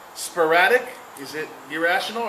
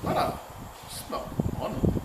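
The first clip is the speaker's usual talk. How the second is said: whispered marvel; 2 semitones lower, 6 dB quieter than usual. Retold or imitated. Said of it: "I go".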